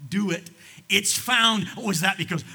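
loud, high preaching burst; preaching shout. Acted. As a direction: pastor hammering it home to the congregation, shouting.

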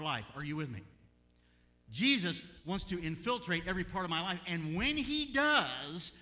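high, loud, stretched-out preacher's cry; preaching at full force. Acted. Preacher calls out to the congregation, loud and high. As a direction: lift the church with it.

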